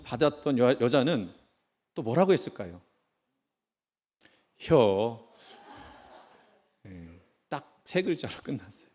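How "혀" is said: a clipped, loud one-word Chungcheong answer; 충청도 사투리로 툭 던지듯; Chungcheong drawl. Acted. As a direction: toss it off flat like a laconic country girl. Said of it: "충청도 유머가 최고입니다".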